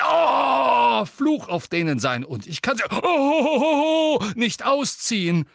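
loud, high complaint broken by long yelps; cries of pain. A narrator's reading. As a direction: explain it while howling in agony. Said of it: "versuchte unter Schmerzensschreien". (unter lauten Schmerzensschreien)

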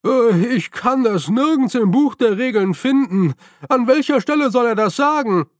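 high, whining plea; a lost, lamenting voice asking for help; high plaintive complaint from behind the lantern. A narrator's reading. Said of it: "eine klagende Stimme ertönte".

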